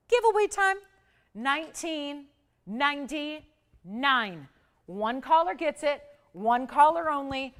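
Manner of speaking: high, loud price-reveal build with drawn-out numbers; giveaway announcer hype. Real, not acted. Host revealing a one-caller giveaway price, hyped announcer.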